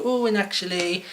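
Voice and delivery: exaggerated high pitch, loud. Shown as a demonstration. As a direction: lift the voice high to keep an interviewer interested. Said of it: "make it like this, and then go".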